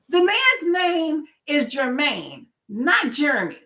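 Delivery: high, slow, emphatic correction; not so nice, drawn-out insistence; firm correction. Retold or imitated. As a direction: spell it out firmly, leaning on "Jermaine". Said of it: "I would be nicer about it".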